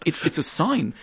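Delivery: usual speaking voice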